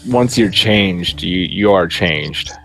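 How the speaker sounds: normal talking voice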